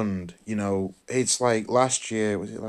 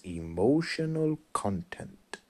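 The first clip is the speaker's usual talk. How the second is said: slow, soft, drawn-out Bruce Lee line; quiet, slow Bruce Lee imitation; imitating Bruce Lee; 4 dB quieter, slower than usual. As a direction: savour each word like Bruce Lee teaching. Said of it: "the way he delivers it when he goes".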